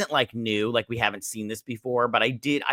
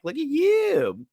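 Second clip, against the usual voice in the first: high, slow cooing tease; teasing admiration; slower than usual. Pretend. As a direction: coo it like to a cute couple. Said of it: "which I'm all like".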